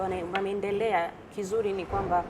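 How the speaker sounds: ordinary talk, nothing marked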